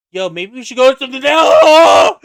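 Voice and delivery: casual start bursting into a high yell; mock panic as the ride launches; cut off screaming. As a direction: let the last word become a scream. Acts being cut off by launch.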